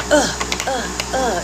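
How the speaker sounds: high, loud little pressing grunts; niece's effort noises. Retold or imitated. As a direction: imitate a small child's effortful "uh". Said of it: "she goes".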